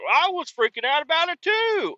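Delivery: put-on very high, fast old-timer squeak; squeaky high old-timer admitting panic; old man voice. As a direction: rattle it off like the fretting old man. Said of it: "He's like".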